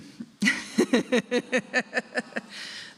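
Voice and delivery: a real, breathy laugh at her own bad luck; long self-mocking chuckle; rueful laugh. Real, not acted. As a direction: laugh it off, amused at the irony. Laughs at the supermoon letdown.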